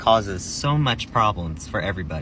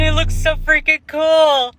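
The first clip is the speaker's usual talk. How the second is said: excited; 4 dB louder than usual. Real, not acted. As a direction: gush with delight. Excited reveal.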